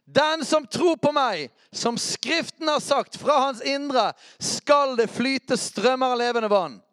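slow, high and loud proclamation; Jesus shouting the promise of living water; crying out. Acted. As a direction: call it out like an exclamation mark. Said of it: "Utropstegn. Jeg liker utropstegn".

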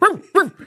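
high, small barking yelps; bark, but not big or throaty; imitated dog bark. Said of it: "and he barks".

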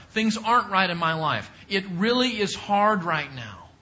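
building prayer of trust and praise; earnest voiced prayer. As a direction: pray it as if speaking to God. Voicing psalmist's prayer to God.

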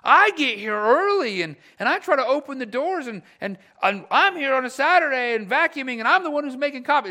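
hammed-up resentful volunteer; mock grumbling. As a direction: whine about doing all the work. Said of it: "start grumbling in our heart".

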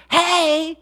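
high, loud complaining yell, acted out; body yelling. Body yelling at its owner over missed caffeine, mock outraged yell. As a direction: holler like a demanding body throwing a mock fit. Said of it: "your body's kind of yelling at you".

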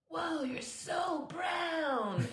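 amazed exclamation. Retold or imitated. Patient's daughter gawking at a nurse, stretched high amazement. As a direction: drag it out like a wide-eyed kid. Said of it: "her daughter was there and goes".

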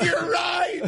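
high laughing yell; laughing shout. Retold or imitated. Agreeing with a friend's joke, high laughing shout. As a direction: shout it through a burst of laughter. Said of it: "I was like".

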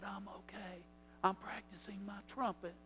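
put-on very soft, hushed child's answer; whispering niece. Niece telling uncle what she is doing, whispering. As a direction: whisper it confidingly. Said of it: "she whispered".

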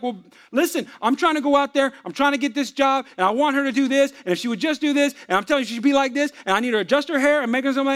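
high, insistent, piling-up excuses; worked-up self-justifying. Retold or imitated. Husband defending himself to his pastor, worked-up insistent delivery.

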